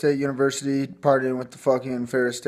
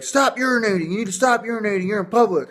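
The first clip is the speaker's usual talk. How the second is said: imitating a cop barking orders, pitched up and louder; hammed-up police shout, higher and louder; mock cop yell; 9 semitones higher, 4 dB louder than usual. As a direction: play the cop yelling at him to stop. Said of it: "they keep yelling at me".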